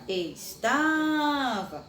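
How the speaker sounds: "Estava" slowed right down with the weight on "ta"; teacher repeating "Estava" slowly for the class; slow stress. Shown as a demonstration. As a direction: stretch "Estava" syllable by syllable, leaning on "ta". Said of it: "palavra estava é acentuada da forma correta".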